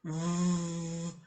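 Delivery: a long, low voiced V-; low buzzing v. Shown as a demonstration. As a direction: hum the V deep in the throat. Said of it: "eu faço mais grave".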